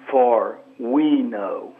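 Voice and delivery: slow, leaning hard on "we"; stress on WE. Shown as a demonstration. Guest showing the host how to meditate on scripture, stress on "we". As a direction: draw out "For we know" and press on "we". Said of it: "put an inflection on a different word".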